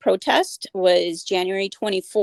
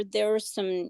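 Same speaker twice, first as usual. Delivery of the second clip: ordinary talk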